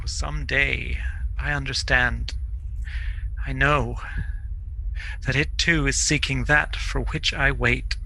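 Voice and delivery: soft, halting, trance-like reply; hushed, with long pauses between phrases; quietly accepting, as if fading; resigned calm. Acted. Dying man explains, fear gone.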